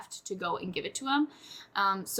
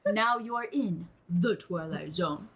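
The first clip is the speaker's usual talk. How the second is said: slow, lower, eerie announcer voice; Twilight Zone narrator; 5 semitones lower, slower than usual. Retold or imitated. Podcaster mimics the show's opening, slow eerie announcer. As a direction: intone it like a spooky TV host. Quoting the TV show intro.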